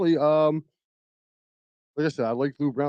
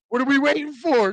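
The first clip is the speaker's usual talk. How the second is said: Rocky's urgent plea; 10 semitones higher than usual. Retold or imitated. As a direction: beg eagerly in a hammed-up movie voice. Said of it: "He goes".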